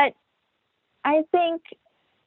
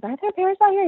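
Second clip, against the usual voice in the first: imitating a child; 11 semitones higher, faster than usual. Kids asking about bears.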